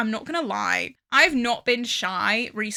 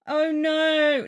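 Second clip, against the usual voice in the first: worried; 6 semitones higher, 5 dB louder than usual. Real, not acted.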